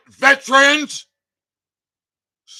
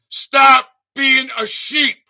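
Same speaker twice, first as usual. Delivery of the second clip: emphatic shout; 6 semitones higher, 9 dB louder, slower than usual. Real, not acted. Urging viewers, shouting.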